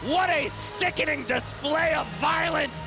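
high excited play-by-play shouting; shocked commentator. Real, not acted. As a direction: react in horror to the choke.

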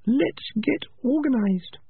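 put-on high young rat voice, drawn out slowly; Peaches, slow and high. A narrator's reading. Peaches urging the clan to get organized, high and slow. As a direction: lay out the plan calmly, in a high voice.

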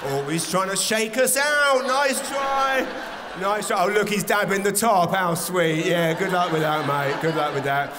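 mock him like a mischievous gang; taunting wee gang. The wee drops taunting the shaking man, cocky loud mockery.

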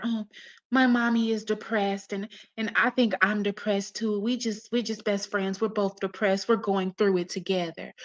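soft, lightly voiced imitation of a child; mock daughter. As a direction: mimic a little girl confiding, quietly. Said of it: "So Robin over there".

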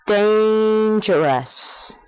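drawn-out Dangerous for repeating; slow model word. Shown as a demonstration. Teacher asking the listener to repeat the sound, slow and clear. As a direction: say Dangerous slowly and clearly for the child to echo. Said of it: "Una vez más".

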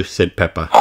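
ordinary delivery, nothing marked

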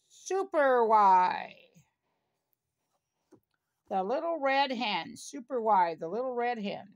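ordinary delivery

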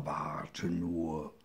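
low, soft menacing growl; drohende Krokodile. A narrator's reading. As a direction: growl it like a lurking crocodile. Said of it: "riefen sie dem kleinen Hirsch nach". (tiefe, leise Drohung der Krokodile)

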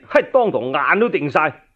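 usual speaking voice

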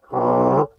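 a low throaty growl, lightly done; soft growl demo. Shown as a demonstration. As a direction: growl softly in the throat like a bull elk. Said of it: "you can growl a little bit in the beginning".

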